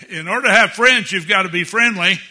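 high, loud preacher's exhortation; full-force pulpit exhortation; fiery preaching. Acted. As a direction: preach it hard to the parents. Preacher building to loud punchline.

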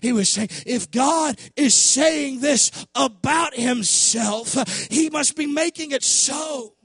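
loud, driving sermon delivery; full-force preaching. Real, not acted. Preacher telling church how Moses bowed in worship, loud full-force preaching. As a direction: preach it hard, leaning on the key words.

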